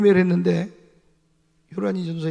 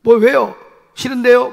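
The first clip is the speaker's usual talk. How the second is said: loud, cheeky what-what-why; defiant talking back; 3 semitones higher, 9 dB louder than usual. Retold or imitated. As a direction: snap back at the pastor like a sassy kid. Defiant student mimicked.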